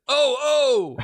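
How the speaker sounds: high, loud "Oh, oh!" of pain; pained old man's wail; groans of discontent. Acted. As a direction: cry out in misery. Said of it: "expressions of discontent".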